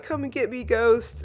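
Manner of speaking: high mock battle taunt; retold defiant challenge. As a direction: call out the ghosts, playfully defiant. Voicing berserk girl challenging the ghosts.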